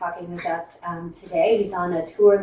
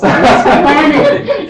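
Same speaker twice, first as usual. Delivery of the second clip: laughing; 7 semitones higher, 14 dB louder, much slower than usual. Real, not acted. Host jokingly praising speaker.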